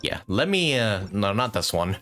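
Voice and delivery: normal talking voice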